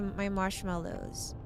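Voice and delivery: ordinary delivery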